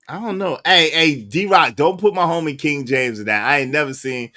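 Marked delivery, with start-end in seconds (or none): none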